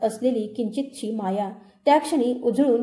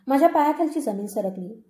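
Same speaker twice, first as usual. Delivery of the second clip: ordinary delivery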